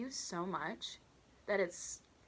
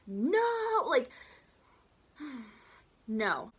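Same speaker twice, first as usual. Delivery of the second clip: high loud cry of disbelief, then a sigh; exasperated no; 5 semitones higher, 6 dB louder, much slower than usual. Retold or imitated. Waitress refusing the customers' complaint, loud and exasperated. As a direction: burst out in disbelief and give up.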